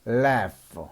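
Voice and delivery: "laugh" ending in a clear F sound. Shown as a demonstration. Teacher shows learners the GH rule, "laugh" ending in F. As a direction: model "laugh" with GH pronounced F. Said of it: "지에이치도 F 발음이 납니다".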